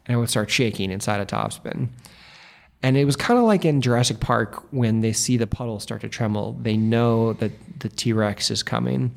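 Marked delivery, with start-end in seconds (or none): none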